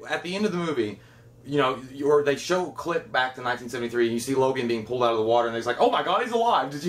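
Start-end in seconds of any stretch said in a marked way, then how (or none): 5.8-6.7 imitated cry of amazement, pitched up; high, exaggerated shock; mock astonishment. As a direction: call out a hammed-up discovery. Imitating rescuer in film.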